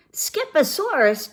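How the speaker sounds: high-pitched, mildly startled declaration; declaring. A narrator's reading. Mama coming into the kitten's room, high declaring exclamation. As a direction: pitch the name up like a challenge. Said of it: "declared Mama Junebug Jones".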